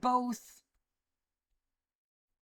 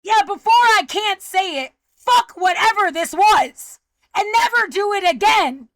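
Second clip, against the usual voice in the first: loud, raised-pitch angry tirade; furious cursing; 4 semitones higher, 6 dB louder than usual. Real, not acted. Speaker cursing the people upsetting them, furious and loud. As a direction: hurl it out with forceful anger.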